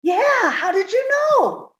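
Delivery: startled. Acted. Irma frightened, surprised.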